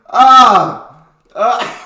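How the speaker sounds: high, very loud "ah"; loud startled cry. Real, not acted. Reacting to the crude phrase.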